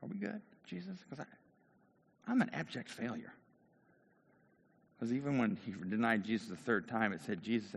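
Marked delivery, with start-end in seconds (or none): 0.0-3.3 soft, high uncertain question; thinking to himself. Acted. Peter silently asks Jesus if they are good, thought aloud softly. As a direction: think it softly, wanting reassurance. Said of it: "he's thinking".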